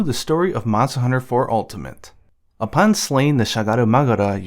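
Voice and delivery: ordinary talk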